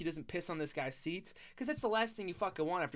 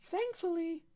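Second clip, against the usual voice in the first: thankfully, said much higher; relieved repeat; 12 semitones higher than usual. Real, not acted. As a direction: echo thankfully in a high squeak of relief.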